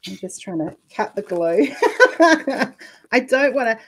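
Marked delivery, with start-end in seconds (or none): 1.7-2.9 laugh. Real, not acted. Laughs while cutting glue.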